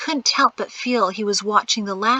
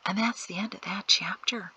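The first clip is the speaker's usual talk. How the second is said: soft sign-off; 6 dB quieter than usual. Real, not acted. Narrator closes chapter.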